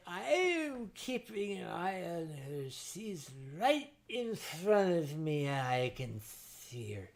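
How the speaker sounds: a hint of a sheepish excuse; friend's reply. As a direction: goat boy explaining himself, softly mocked. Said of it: "He said".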